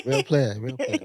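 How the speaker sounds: real player said with a laugh; laughing emphasis. Shown as a demonstration. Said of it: "it's real".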